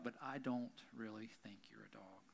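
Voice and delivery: whispered confidential aside; hushed secret aside to the woman. Acted. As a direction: lean in and whisper it to her alone.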